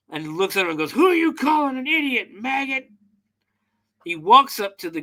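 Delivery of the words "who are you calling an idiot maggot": much higher, snarling threat in mock rage; retold angry bully shouting down a wimp; pissed-off bully. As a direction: bark it at the scrawny guys like a bully. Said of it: "and he's pissed".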